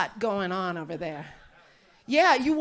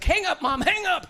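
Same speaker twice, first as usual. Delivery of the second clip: louder, pitched-up plea to hang up; embarrassed teen urging mom, raised voice; urgent plea; 7 semitones higher, 4 dB louder than usual. Retold or imitated. Teen begging her mom to hang up the phone, loud and urgent. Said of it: "I'm like".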